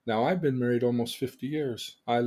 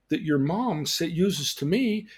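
normal talking voice

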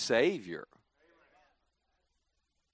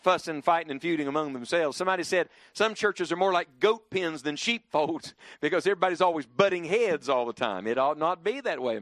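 ordinary talk, nothing marked

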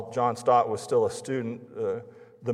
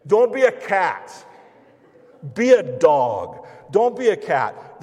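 loud exhortation; 7 semitones higher, 10 dB louder than usual. Real, not acted. Preacher exhorts congregation.

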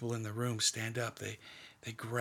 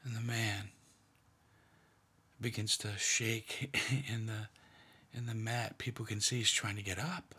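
hushed, half-whispered meditation, leaving long pauses; guided meditation. Acted. Leader guiding a prayerful meditation, in a hushed voice.

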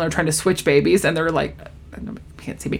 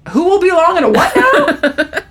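high-pitched, incredulous retold question; stunned disbelief; 10 semitones higher, 3 dB louder than usual. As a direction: mimic someone blindsided by the news, pitch shooting up. Stunned mother reacts to twins news.

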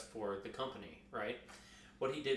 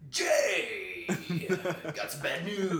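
radio voice; 4 semitones higher, 4 dB louder than usual. Retold or imitated. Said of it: "couldn't turn off his radio voice".

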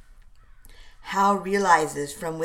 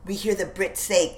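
plain reading voice

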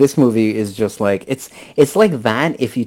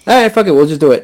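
loud, higher, offhand movie director; careless director; 5 semitones higher, 7 dB louder, faster than usual. Retold or imitated. Retelling a director shrugging off the script, offhand and loud. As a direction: toss it off like a director who doesn't care. Director voiced in retold story.

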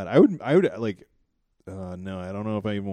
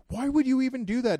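high-pitched, incredulous imitation; mock outraged disbelief, pitched way up; indignant girlfriend; 4 dB louder than usual. Girlfriend confronting him about a fart, indignant.

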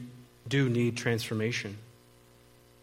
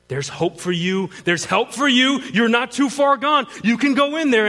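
raised, louder, insistent urging; urgent encouragement; 9 semitones higher, 6 dB louder than usual. Retold or imitated. Holy Spirit urging a hesitant man inside, urgent encouragement. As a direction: build each promise higher and louder.

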